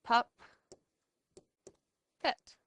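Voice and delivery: pup and pet said apart with a pause between; teacher sounding out pup, pet; split into syllables. Shown as a demonstration. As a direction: break puppet into pup, then pet. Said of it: "split up the double letters".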